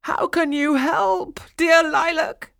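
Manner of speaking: high, loud, anguished wail; weeping. A narrator's reading. King begs Lilac for help, weeping. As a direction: plead in slow, wailing grief. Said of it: "wept the king".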